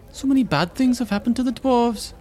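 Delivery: put-on little girl voice.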